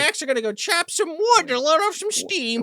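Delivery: high, overly casual cover story; mock downplaying. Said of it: "tries to downplay it".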